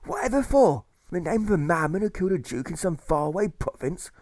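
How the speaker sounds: high, ringing protest speech; impassioned orator. Acted. Anti-war orator rallying the crowd, impassioned. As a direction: rouse the crowd from the platform. Speaker voiced in narrated story.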